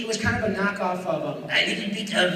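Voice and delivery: ordinary delivery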